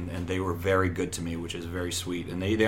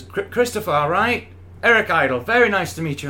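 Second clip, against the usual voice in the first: a light, higher impression of Eric Idle; imitating Eric Idle; 9 semitones higher, 5 dB louder than usual. Eric Idle greeting the nervous newcomer, lightly imitated. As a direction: greet him like a chirpy English comedian, higher. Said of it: "and he says".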